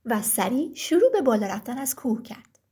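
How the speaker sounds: ordinary delivery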